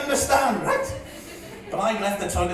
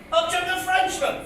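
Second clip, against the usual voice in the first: higher, projected recital voice; declaimed verse; 10 semitones higher than usual. Acted. Comedian introducing a Frenchman in his poem, declaimed. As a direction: announce the new character in verse. Recited line, then repeated aside.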